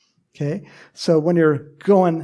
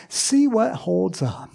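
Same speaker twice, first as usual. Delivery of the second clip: normal talking voice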